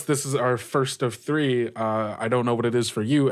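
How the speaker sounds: normal talking voice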